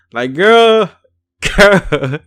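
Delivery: loud, high exclamation breaking into laughter; laughing disbelief. Real, not acted. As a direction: exclaim it and crack up. Reacting in disbelief to the boast.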